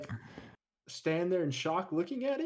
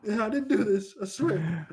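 higher and louder, protesting; mock panicked plea; 7 semitones higher, 4 dB louder than usual. Imagined guy repeating his innocence to a witness, high protest. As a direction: insist you're innocent, voice up high.

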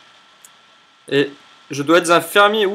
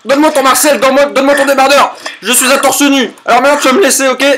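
mock bossy character voice; 8 semitones higher, 7 dB louder, faster than usual. Bossing a game character around.

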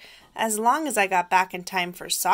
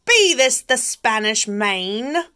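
loud, slow pirate holler; pirate yell; 4 semitones higher, 8 dB louder, slower than usual. Acted. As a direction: bellow it like a pirate captain. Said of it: "the head pirate climbed out and yelled".

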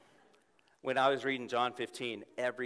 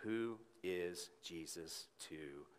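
softer, lower, gentle voice of God; gently; 3 semitones lower, 5 dB quieter than usual. Retold or imitated. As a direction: speak low and tender, like God in a quiet room. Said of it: "said to me gently".

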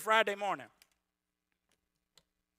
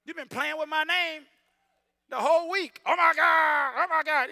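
God teasing; 9 semitones higher, 10 dB louder than usual. Pretend. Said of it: "he like".

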